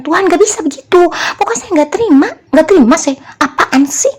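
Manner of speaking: high, loud, stubborn protest; angry refusal. Retold or imitated. Venting at God while at work, angry refusal. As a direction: act out a fed-up rant. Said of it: "Saya marah-marah".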